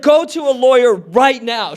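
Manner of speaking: high, loud retold command; urgent mom. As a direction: imitate mom giving an urgent order. Mom orders him urgently, retold.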